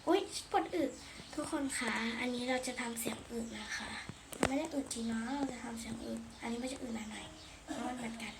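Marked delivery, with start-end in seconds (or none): 1.3-7.9 hushed unvoiced aside; breathy secret aside; whisper. Real, not acted. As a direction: whisper it so nobody hears.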